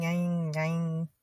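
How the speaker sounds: "yang, yang" with a long nasal twang on the vowel; twang demo. Shown as a demonstration. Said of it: "is the twang".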